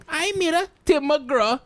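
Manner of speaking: high-pitched imitated jeer; mock heckle. Class clown teasing cowboy classmate, high mock heckle.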